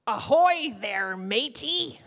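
slow, loud ahoy like a pirate; slow loud pirate greeting; pirate call. Shown as a demonstration. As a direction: hail them like an old sea dog. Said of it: "And I said something like this".

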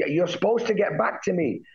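high, indignant retold scolding; livid reproach. Scolding the contact who never replied, high and indignant. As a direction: scold them, voice lifted high. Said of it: "'cause I was so livid".

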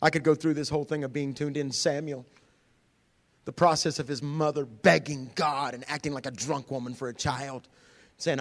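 normal talking voice

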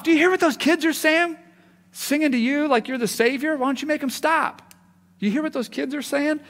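high, loud outraged complaint; indignant authorities. Retold or imitated. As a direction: authorities complain to Jesus about singing kids, high and outraged.

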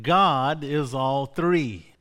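ordinary talk

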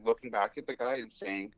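usual speaking voice

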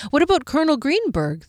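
eager hopeful question. A narrator's reading. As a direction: hopefully suggest a name. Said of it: "the elf put in hopefully".

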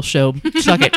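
talking with mouth full; words round a bite of food. Real, not acted. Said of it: "I'm gonna talk with my mouth full".

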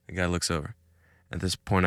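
ordinary talk, nothing marked

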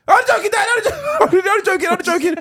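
very high, squealed protest; hammed-up high-pitched plea; imitated panicking daughter. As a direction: squeal it like a kid pleading with Dad. Retells daughter's panicked plea to Dad.

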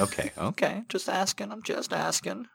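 high, playful mock defence; sing-song just asking, feigning innocence; mock defensive. Real, not acted. Host teasing the caller about her favourite actor, mock innocent.

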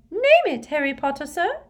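loud, very high squeak; beaming high-pitched house-elf; eager squeaky elf. Acted. Narrator voices eager elf reply.

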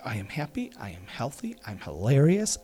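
soft, quiet hint of an American accent; quiet American-accented kid. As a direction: son whispering his affirmation with a touch of an American accent. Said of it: "an American accent".

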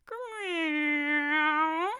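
loud, high drawn-out meow; angry cat yowl. A narrator's reading. Cat bouncing in from the hated snow, angry yowl. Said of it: "with an angry".

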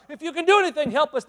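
high, loud begging cry; desperate plea. Acted. Father pleading with Jesus, loud and high. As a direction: cry out for help. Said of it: "I can feel his urgency".

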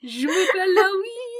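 high, wailing French ghost. Pretend. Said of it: "demonstrate our French ghost Howie".

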